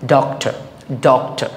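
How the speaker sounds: Doctor with ডক pressed, the ending let fall; careful model doctor, weight up front; stress on first syllable. Shown as a demonstration. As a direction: lean on the opening ডক of doctor. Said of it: "first syllable আমরা কি করব".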